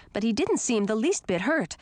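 usual speaking voice

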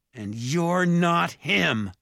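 high, slow, emphatic accusation; yelling. A narrator's reading. Jared rejects the impostor father, yelling. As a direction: throw it at him, pitched high. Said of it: "Jared yelled".

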